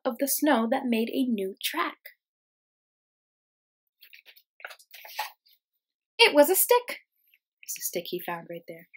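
put-on lively, expressive read-aloud for kids; reading to children. Teacher reading a picture book to her class, lively storytime voice.

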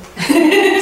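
high, loud laugh; real loud laugh; burst of laughter. Real, not acted. Laughing at the story.

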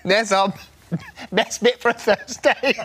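ordinary talk

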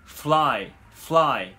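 "fly" with no vowel between F and L; English "fly" as a single syllable; consonants linked. Shown as a demonstration. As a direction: slide straight from f into l in "fly". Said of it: "母音を間に入れずに".

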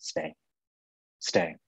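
inner voice. Retold or imitated. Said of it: "something in me just said".

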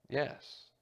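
ordinary delivery

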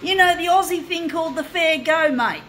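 loud, high and indignant; indignant outburst. Real, not acted. Angry rant at the news people.

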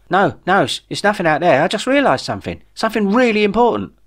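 a worked-up Jeff with big news. Acted. Jeff reassures the panicked Ray.

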